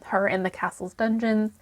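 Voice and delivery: ordinary delivery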